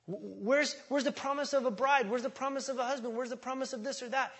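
high, fast mock whine at God; whiny complaint to God. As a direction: mimic a frustrated believer griping. Mock complaining believer again.

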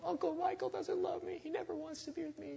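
very high, mock-crying little voice; imitated tearful child. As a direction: wail like a heartbroken little niece. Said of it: "started to cry and just be destroyed".

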